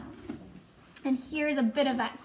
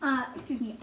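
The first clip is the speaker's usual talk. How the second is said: plain reading voice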